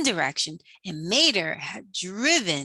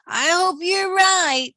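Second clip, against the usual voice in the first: high, uneasy hope; hope it out a little scared; nervous high voice; 9 semitones higher, 6 dB louder than usual. A narrator's reading. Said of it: "said Mater".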